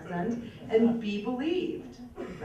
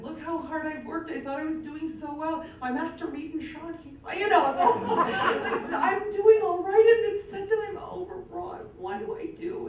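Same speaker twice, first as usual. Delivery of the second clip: pleading complaint; 6 semitones higher, 4 dB louder than usual. Retold or imitated. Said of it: "And I said".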